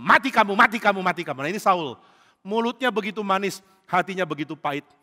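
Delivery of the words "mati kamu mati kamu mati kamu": inner hatred. Acted. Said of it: "dalam hatinya".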